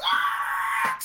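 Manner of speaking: very high startled shriek; imitating the driver's sudden scream; frightened yelp. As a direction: shriek like you've just seen something.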